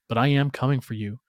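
ordinary delivery, nothing marked

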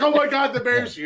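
high, loud laughing gasp; mock shock. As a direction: act floored by the sight. Imitating Jeff's shocked reaction.

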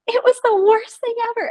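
high, wailing mock despair; imagined amputee patient, a hint of hammed-up despair. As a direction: ham up the patient's distress lightly. Imagined distraught patient quote.

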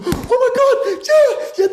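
very high, slow, laughing cheer of relief; giddy high oh my God; relieved celebration. Retold or imitated. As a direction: squeal like a relieved kid who scored. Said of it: "most kids would go".

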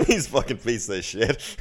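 a touch of a scornful warden, laughing; mock warden. Warden scorns the prisoners, laughing. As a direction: sneer it like a fed-up warden. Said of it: "He's like".

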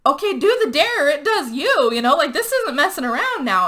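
high, loud retold urging; mock-frantic insistence, pitch swooping up; urgent pleading. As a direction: press someone to act, acting it out. Friends urge vegan to do dare.

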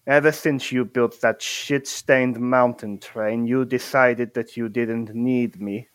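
put-on slow, measured accented villain voice; menacing villain. Villain addressing former friends of Gumpleton, slow menace. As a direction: take your time, spite in every phrase. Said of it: "what you hear is this".

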